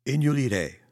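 loud, high teacher's command; grumbling order. A narrator's reading. Teacher cuts off the protesting children, grumbling loudly. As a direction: call the class into line, grumbling. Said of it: "mopperde de meester".

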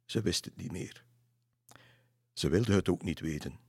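ordinary delivery, nothing marked

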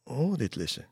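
high, startled gasp of relief; a sudden little oh; bright surprised exclamation. Acted. Child startled awake from dream.

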